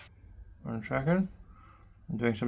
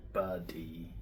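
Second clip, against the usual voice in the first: low, soft little sheep voice; a shy creature saying its name; leaf sheep voice; 6 semitones lower, 10 dB quieter than usual. Pretend.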